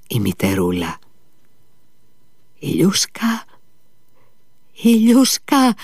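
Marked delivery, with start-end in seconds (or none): 2.6-5.7 high, slow wailing call; weeping mother crying her son's name, high and drawn out. A narrator's reading. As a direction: wail the name through tears. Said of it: "φώναζε κι αυτή".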